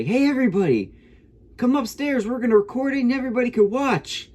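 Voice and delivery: high-pitched mock yell to a crowd; mock screaming. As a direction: imitate a girl calling everyone in, way up high. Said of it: "she starts screaming to everybody".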